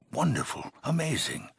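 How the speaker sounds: high, delighted exclamation; elated praise. Acted. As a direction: marvel at the achievement, pitch leaping up. Overseer praises returning hero.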